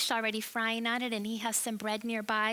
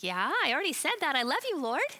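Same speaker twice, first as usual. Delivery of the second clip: quick, higher and louder, a touch impatient; hammed-up Peter, a bit put out; mild exasperation; 4 semitones higher, 4 dB louder, faster than usual. Peter answering Jesus a second time, mock impatient.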